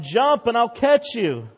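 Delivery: father yelling. Retold or imitated. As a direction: yell up to a trapped son. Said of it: "His father kept yelling".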